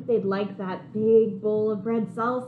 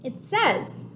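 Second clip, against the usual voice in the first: plain reading voice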